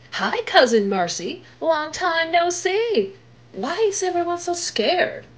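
put-on a bright kid asking a worried question; eager girl voice. Velma greets her cousin.

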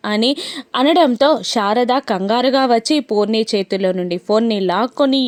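ordinary delivery

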